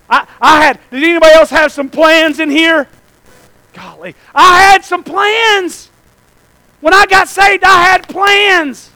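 loud, high, animated testimony; preach. Retold or imitated. Preacher recalls reassuring his wife about church, loud animated preaching. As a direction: retell it at full pulpit volume.

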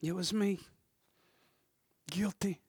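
soft acted admission of guilt; hushed confession. Preacher acting the woman confessing, hushed confession. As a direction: own up to it quietly.

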